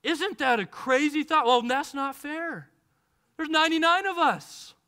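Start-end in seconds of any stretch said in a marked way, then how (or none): none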